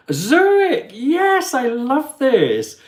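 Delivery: high, loud delighted squeal; excited. Real, not acted. Greeting a Zurich viewer, high loud excitement.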